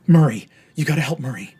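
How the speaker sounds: loud, urgent, panicked pleading; begging his sibling for help before the hanging; desperate plea. Acted. A condemned brother pleads telepathically, loud and panicked. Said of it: "He goes".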